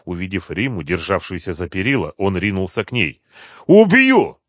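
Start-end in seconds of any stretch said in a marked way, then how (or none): 3.7-4.4 very high, loud yell; furious threat. A narrator's reading. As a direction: yell it as you lunge to attack. Thug lunges at her threatening murder.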